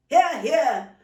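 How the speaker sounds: loud, booming agreement; hearty hear hear. Acted. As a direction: cheer the grace like a proud uncle. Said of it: "agreed Uncle Isaac".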